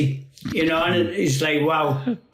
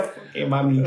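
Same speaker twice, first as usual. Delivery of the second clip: usual speaking voice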